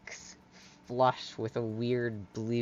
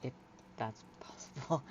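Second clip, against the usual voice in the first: higher, halting, bashful voice; awkward Hyla; 8 semitones higher, 6 dB quieter than usual. Acted. Hyla asking friend to catch up tonight, bashful. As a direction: fumble shyly between small laughs.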